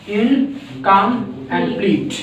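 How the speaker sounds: Incomplete slowly split as "इन - कम - pleat"; broken into syllables. Shown as a demonstration. Teacher showing three syllables in incomplete, broken into syllables. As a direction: say incomplete in three drawn-out beats with pauses. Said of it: "तीन syllables हैं".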